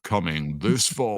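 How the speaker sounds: slow, drawn-out American trailer announcer; movie trailer voice. Acted. Reminding co-host of the forgotten trailer voice, slow movie-trailer voiceover. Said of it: "You forgot the movie trailer voice".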